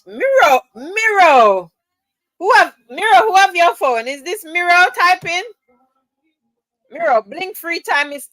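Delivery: high, loud excited calling; excited mock alarm. Real, not acted. To Miro in chat, high excited disbelief. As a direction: call out to him in amused disbelief.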